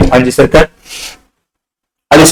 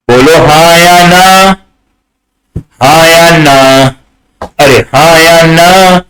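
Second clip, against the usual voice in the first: long drawn-out syllables on a held note; stretched, chant-like prompting; calling for answers; much slower than usual. Real, not acted. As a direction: slowly sing out the question to the class.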